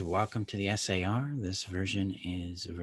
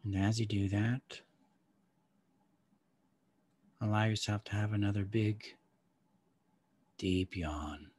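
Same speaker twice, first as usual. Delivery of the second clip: calm guide easing them inward; guided meditation. Acted.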